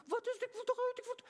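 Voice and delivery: very high, panicky shriek of women in a stuck lift; panicked women shrieking. Retold or imitated. Preacher imitating women screaming in a stuck lift, very high mock panic. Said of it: "ze begonnen te gillen".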